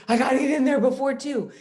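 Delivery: high, loud frantic rush; mock urgency. Night nurse with meds due before two, frantic. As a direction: blurt it high and loud, as if racing a deadline.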